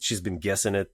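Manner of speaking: usual speaking voice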